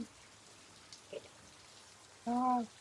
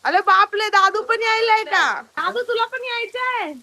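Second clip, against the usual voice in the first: higher and louder delighted call; excited greeting; 6 semitones higher, 3 dB louder than usual. Real, not acted. Mother greeting the arriving brother, excited and loud.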